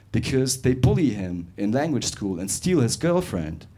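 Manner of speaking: usual speaking voice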